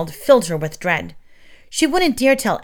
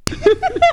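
high cackling laugh that rises; 4 dB louder than usual. A narrator's reading. Agatha cackling at Gobbler's joke, high laugh. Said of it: "she was cackling along with him".

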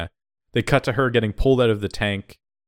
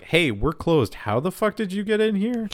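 higher-pitched indignant challenge; shop woman; 10 semitones higher than usual. Retold or imitated. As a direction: put on a woman confronting an intruder. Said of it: "this woman's like".

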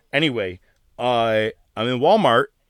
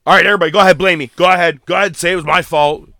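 louder defiant mock taunt; a hint of a cocky wrestler; Priest impression; 4 semitones higher, 8 dB louder, faster than usual. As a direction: dare the room to blame him. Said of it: "I did like his whole".

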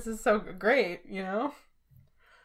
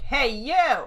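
higher and louder call-out; mock confrontation; 4 semitones higher, 5 dB louder than usual. Fan confronting John Mulaney about the stolen bit, mock call-out. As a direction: confront a stranger across a room. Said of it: "so I can be like".

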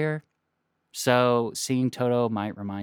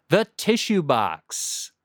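segment title announcement; 5 semitones higher, much slower than usual. Acted.

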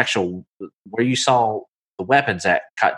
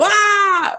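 sudden, much higher startled cry; startled yelp; 4 dB louder than usual. Retold or imitated. Dockside bystanders startled by an arrow, high yelp. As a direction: yelp as if an arrow just flew past. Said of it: "you kinda hear some people go".

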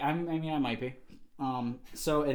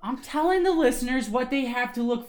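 higher-pitched, louder telling-off; exasperated scolding; 9 semitones higher, 6 dB louder than usual. Real, not acted. Host scolds the intern.

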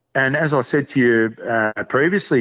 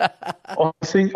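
a laugh spilling into the words; 5 semitones higher than usual. Real, not acted. Laughing as he answers his mate. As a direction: chuckle it out, pitched up.

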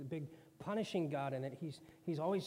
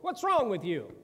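louder, scolding harsh God voice; jerk God; 5 semitones higher, 11 dB louder than usual. Acted. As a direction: snap at Job like a bully. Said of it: "That would be a jerk God".

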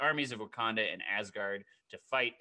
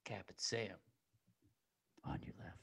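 very soft, whispery radio call; hushed voice coming through an earpiece; crackly earpiece voice; 5 semitones lower, 11 dB quieter than usual. Acted. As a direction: imitate a distant comms message. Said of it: "gets a little crackle in his ear".

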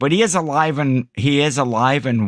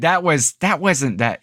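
ordinary talk

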